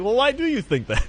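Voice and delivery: high, sweet mock-innocent question; poking a Twitter poster, mock innocent; innocent questioner. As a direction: ask it all wide-eyed. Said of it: "It's like".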